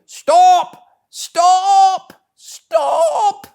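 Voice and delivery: a barge woman screaming after a thief; shouting. A narrator's reading. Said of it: "and shouting".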